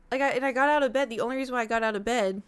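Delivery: ordinary talk, nothing marked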